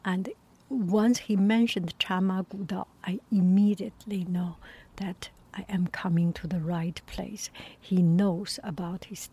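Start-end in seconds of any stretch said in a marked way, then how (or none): none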